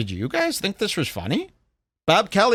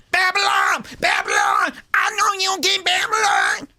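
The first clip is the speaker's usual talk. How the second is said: screaming rapper. Retold or imitated. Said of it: "which is screaming".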